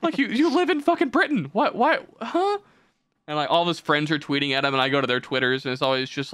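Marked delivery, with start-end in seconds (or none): 0.0-2.6 very high, incredulous questioning; baffled disbelief. Acted. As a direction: pitch it way up, utterly confounded. Baffled retort to the tweeter.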